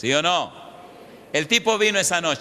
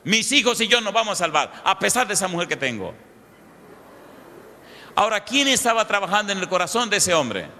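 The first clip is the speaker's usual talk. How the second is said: raised, pressing sermon voice, driving each question home; fervent preaching; 5 semitones higher than usual. Acted. Preacher pressing the congregation with questions, fervent and raised.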